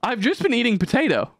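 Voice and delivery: high-pitched dawning realization; mock startled self-discovery; sudden realization.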